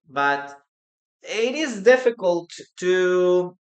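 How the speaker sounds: usual speaking voice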